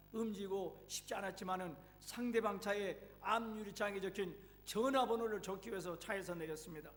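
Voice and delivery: ordinary talk, nothing marked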